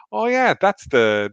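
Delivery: a mock-breezy in-law, unbothered; imitating sister-in-law, breezy. Sister-in-law casually recalls the forgotten shopping day, high and slow. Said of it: "She was there going".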